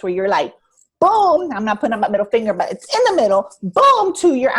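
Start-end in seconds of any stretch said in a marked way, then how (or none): 1.0-1.5 high, loud punchy boom. Retold or imitated. Pictures a burst of stress. 3.6-4.1 high, loud punchy boom. Retold or imitated. Pictures a burst of stress.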